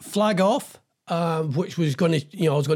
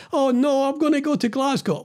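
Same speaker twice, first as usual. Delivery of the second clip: higher, whiny mock moan; mock whining complaint; 7 semitones higher than usual. Mocking BBC staff who moan about moving, mock whine. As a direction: mimic a moaning staff member, a bit higher. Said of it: "some people complaining about they're going to Glasgow".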